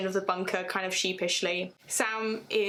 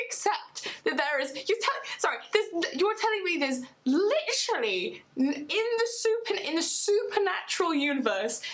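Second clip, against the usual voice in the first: indignant rant; 9 semitones higher than usual. Real, not acted. Fan rejecting that Cass can't return, real incredulous rant. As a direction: pitch jumps much higher, words tumbling in disbelief. Fan ranting against other writers.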